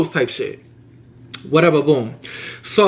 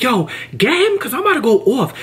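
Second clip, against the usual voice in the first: mock coworker; 7 semitones higher than usual. Coworker going to manager.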